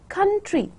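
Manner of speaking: COUNTRY ending on a light ee, pitched high; high COUNTRY with unstressed ee at the end; final E sound. Shown as a demonstration. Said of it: "in the end you have this E sound".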